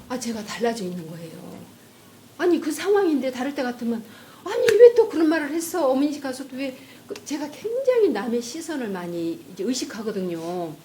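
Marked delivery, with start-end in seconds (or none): 4.4-6.7 mock nagging. Imitating her old nagging.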